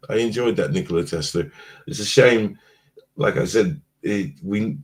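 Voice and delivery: ordinary talk, nothing marked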